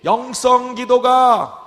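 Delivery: loud, slow, drawn-out pulpit prayer; fervent preaching prayer. Real, not acted. Pastor leading the congregation into prayer, loud and slow. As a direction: pray at full force, stretching each phrase.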